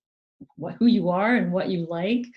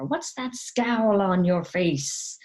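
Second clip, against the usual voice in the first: slow, higher mock chiding; scolding parent; 4 semitones higher, 3 dB louder, slower than usual. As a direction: draw out the parent's disapproval, slowly. Parent voiced shaming angry child.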